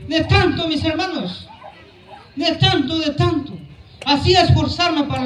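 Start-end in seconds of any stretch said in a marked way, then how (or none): none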